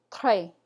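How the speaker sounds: très with guttural French R, said clearly. Shown as a demonstration. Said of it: "Next, we have the word".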